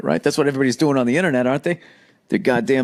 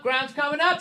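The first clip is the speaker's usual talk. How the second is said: squeaky high mock alarm, softer; mock alarm, higher; 13 semitones higher, 5 dB quieter than usual. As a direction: squeal it like the ground is rushing at you. Mock falling selfie angle.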